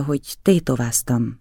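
usual speaking voice

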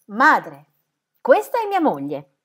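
formal announcement. A narrator's reading. Son presenting his wife to his mother, announcing.

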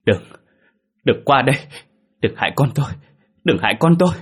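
high, loud panicked cry building in pitch; terrified scream. A narrator's reading. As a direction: scream to keep an attacker off your baby. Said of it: "rồi gào lên". (gào lên hoảng loạn)

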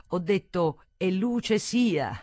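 loud, high, slow proclamation; elated. A narrator's reading. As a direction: declaim it, drunk with joy. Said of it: "Sembrava ebbra, eccitata".

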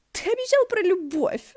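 put-on high, loud squealing girl; shrill squeal. A narrator's reading. Girl with a noose squealing at the storyteller, squealing. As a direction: squeal it out like an excited young girl. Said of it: "заверещала бойкая девица".

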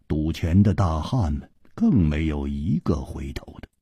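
usual speaking voice

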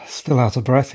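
normal talking voice

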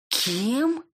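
high bristling comeback. Acted. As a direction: snap back, high and prickly. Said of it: "ощетинилась я". (ощетинившись)

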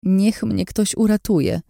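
pleading. Acted. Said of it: "błagałam".

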